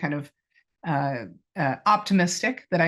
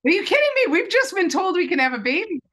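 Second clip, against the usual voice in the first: much higher and louder, disbelieving; incredulous outburst; 13 semitones higher, 6 dB louder than usual. Retold or imitated. Wife to husband over baby news, incredulous outburst. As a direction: burst out in disbelief. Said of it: "And I was like".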